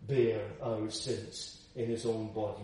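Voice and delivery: usual speaking voice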